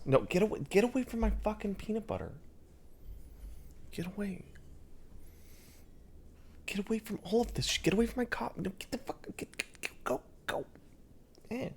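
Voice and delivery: high, hushed hiss shooing the cat off. Real, not acted. Shooing cat from peanut butter.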